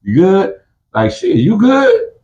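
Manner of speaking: very high mock concern; checking in. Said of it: "you gonna be like".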